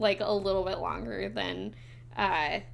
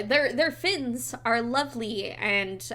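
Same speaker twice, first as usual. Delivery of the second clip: ordinary delivery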